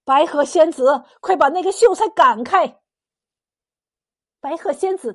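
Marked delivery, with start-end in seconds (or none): 0.0-2.8 loud, anxious yell across a distance; raised, pressing cry, climbing higher; urgent shout. A narrator's reading. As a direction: call out a frantic warning. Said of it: "急得向雾团大喊".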